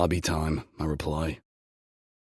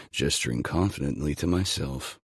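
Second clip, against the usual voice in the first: usual speaking voice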